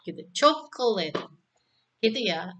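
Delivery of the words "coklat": Coklat split into three clear parts, co-ko-lat; syllable by syllable. Shown as a demonstration. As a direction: spell out Coklat in three beats. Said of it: "Coklat itu ditulis menjadi tiga bagian".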